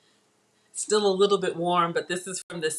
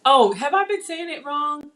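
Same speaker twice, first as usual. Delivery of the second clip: rising, louder surprised question; 8 semitones higher, 6 dB louder than usual. Real, not acted. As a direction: caught off guard by a correction. Reacting to chat correction.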